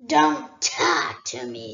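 low, slow menacing dragon voice; a great dragon toying with a thief; dragon Smaug. Acted. Smaug scolds Bilbo.

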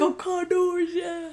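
high-pitched mock cheer; super hyped fan. Joining a friend's retelling of the playoff game, mock hyped cheer. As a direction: echo a hyped-up fan's chant. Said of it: "Stoudemire is super hyped".

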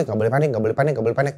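soft, low repeated mantra to himself; self-talk. Retold or imitated. Survivor telling himself not to panic, quiet self-talk. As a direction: keep repeating it under your breath. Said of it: "Gue pokoknya ngomong sama diri gue".

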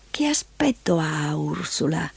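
put-on slow, hushed old granny voice; soft, slow elderly voice; frail old woman. A narrator's reading. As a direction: ask softly and feebly, almost whispering. Frail old grandmother asks.

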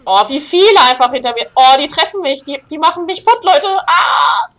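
loud, frightened yelling; panicked cries. Real, not acted. Gamer chased and hit by monsters, panicked yelling. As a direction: cry out as you're being hit.

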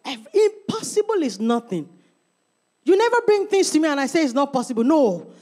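usual speaking voice

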